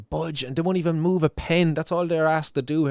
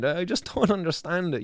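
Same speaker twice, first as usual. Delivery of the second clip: normal talking voice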